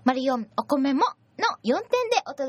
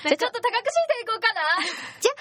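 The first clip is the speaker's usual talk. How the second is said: raised pitch, fast and bright; higher voice; 11 semitones higher, faster than usual. Shown as a demonstration. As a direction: pitch the voice up on purpose. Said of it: "声低くなっちゃう".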